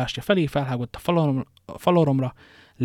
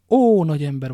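loud, higher exclamation of admiration; admiring exclamation; 4 semitones higher, 8 dB louder than usual. A narrator's reading.

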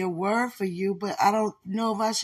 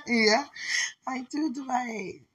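tender; 7 semitones higher, slower than usual. Real, not acted. Tender answer to fiancé.

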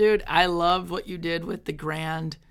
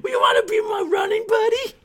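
high school Justin impression; 11 semitones higher than usual. Mocking a friend as a teen offering gym help, high and eager. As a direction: squeak it out like an eager gym nerd. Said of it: "impression of me at high school".

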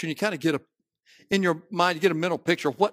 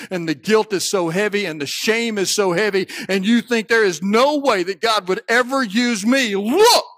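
loud, high, building intensity; impassioned preaching; 6 semitones higher, 8 dB louder than usual. Real, not acted. Preacher to those weighed down by guilt, impassioned preaching. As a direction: build steadily to a shouted command.

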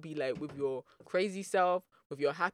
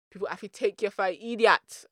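put-on louder, higher Jamaican accent; 4 semitones higher, 8 dB louder than usual. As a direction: put on an emphatic Jamaican lilt. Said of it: "I'm gonna do a Jamaican accent".

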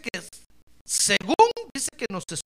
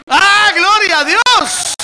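loud, slow, drawn-out cry of praise; booming hallelujah from the pulpit; preaching shout; 11 semitones higher, 20 dB louder, much slower than usual. Acted. Preacher exclaims at full force.

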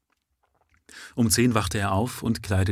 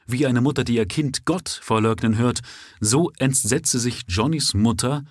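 usual speaking voice

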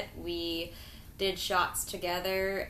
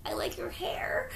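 whisper; 10 semitones higher, 5 dB quieter than usual. Retold or imitated. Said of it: "I was like".

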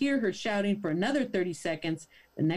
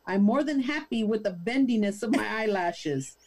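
ordinary delivery

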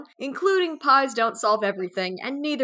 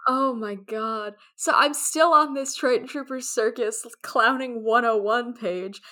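ordinary talk

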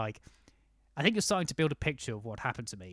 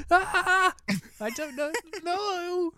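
high, loud, whimpering panic, half crying; panicked inner whimper; 8 dB louder, much slower than usual. Retold or imitated. Tipsy tourist in German shop panicking at stranger's offer, high mock whimper. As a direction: squeal in mock panic, voice cracking upward.

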